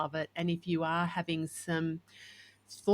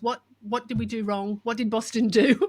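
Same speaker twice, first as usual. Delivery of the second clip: acting out a worried first thought, higher pitch; rising, louder, playfully panicked questioning; mock alarm; 5 semitones higher, 8 dB louder than usual. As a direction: replay a jolt of worry for the listener. Retelling her first worried thought.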